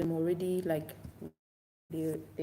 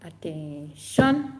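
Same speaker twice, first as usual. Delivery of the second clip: slow "attention" swelling to a loud, rising end; attention call; 2 semitones lower, 10 dB louder, much slower than usual. Shown as a demonstration. Teacher shows how to gain their attention, drawn-out call. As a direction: stretch it out and punch the last syllable. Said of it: "So when you say".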